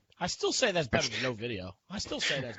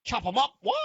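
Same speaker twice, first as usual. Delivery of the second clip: lightly voiced mock ninja line; a hint of ninja bravado; playful ninja voice; 10 semitones higher, 3 dB louder than usual. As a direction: snap it out like a cartoon ninja. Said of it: "I went all ninja on you".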